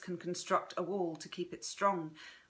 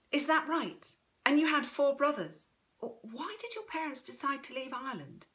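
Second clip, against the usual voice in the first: higher-pitched, curious granddaughter; higher voice, keen questioning; Chrissy, inquisitive; 6 semitones higher than usual. Acted. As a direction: fire off eager questions in a higher voice.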